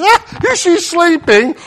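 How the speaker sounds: high, scoffing mock question. Mourners mocking Jesus, high scoffing voice. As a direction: sneer at the idea she sleeps. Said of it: "started laughing at Jesus".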